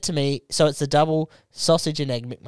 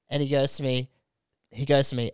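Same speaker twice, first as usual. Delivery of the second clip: usual speaking voice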